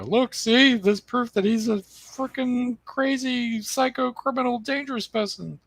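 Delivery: high, slow mocking voice as if accusing; mocking quote. To the audience, mocking how they'd paint him. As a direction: squeal it out like a hysterical accuser. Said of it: "and go".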